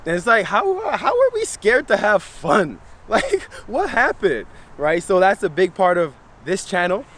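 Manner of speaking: ordinary talk, nothing marked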